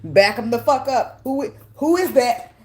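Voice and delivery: hammed-up guard-up warning; mock warrior pushback. Warrior woman to an unexpected lover, mock back-off laughing. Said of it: "you be like".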